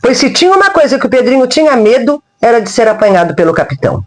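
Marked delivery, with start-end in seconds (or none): none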